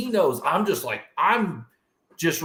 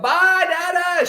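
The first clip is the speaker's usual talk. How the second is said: high, loud goodbye yelled with a slow stretch; child's yell; 6 dB louder, slower than usual. Retold or imitated. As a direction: call out goodbye to dad like a little girl. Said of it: "and she yelled".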